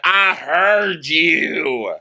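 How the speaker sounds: high, slow, curt reply; curt high drawl. Retold or imitated. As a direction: drag it out, high and cold. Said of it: "he's like".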